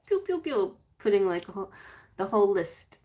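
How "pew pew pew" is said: mock laser noises. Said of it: "I'd be like".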